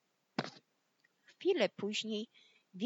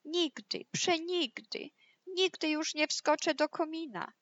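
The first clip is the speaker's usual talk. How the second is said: high, louder wailing vow; whimpering wolf; 8 semitones higher, 4 dB louder than usual. Acted. Burnt wolf swears off chimneys, high whimpering wail. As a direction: whine it high, each phrase falling. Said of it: "skomlał wilk".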